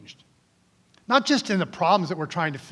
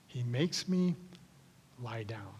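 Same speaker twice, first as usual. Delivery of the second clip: soft meditative whisper; 6 semitones lower, 11 dB quieter than usual. Shown as a demonstration. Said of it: "something absolutely crazy like this".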